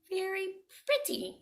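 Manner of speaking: high and soft, pleased; admiring pig. Acted. Pig admiring his stick house, high and soft. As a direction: coo over the new house.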